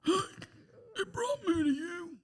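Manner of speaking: high, slow, soft crying voice; breathless crying. Acted. Jack struggling for breath, crying to Rose. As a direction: strain for each word through tears. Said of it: "having trouble getting the breath to speak".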